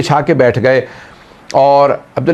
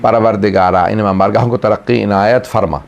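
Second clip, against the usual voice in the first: closing prayer; 6 semitones lower than usual. Acted. Closing supplication.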